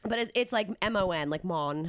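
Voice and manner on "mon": "Mon" with a Rastafarian rounded vowel; Rastafarian accent. Shown as a demonstration. Host explains a name ending, "Mon" in light Rastafarian accent. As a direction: say "Mon" as a Rastafarian says man. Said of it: "like a Rastafarian says man".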